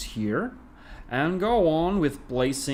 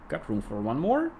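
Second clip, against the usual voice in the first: usual speaking voice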